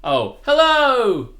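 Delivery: high, loud, slow drawn-out hello; passionate hello. Shown as a demonstration. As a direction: greet with big passion. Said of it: "the other way round for me".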